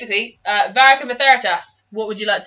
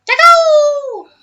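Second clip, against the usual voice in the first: high, loud tiny critter; pixie; 8 dB louder than usual. Acted. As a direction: squeal like a little critter. Tiny creature cries its name.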